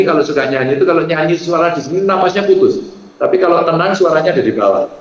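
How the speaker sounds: ordinary delivery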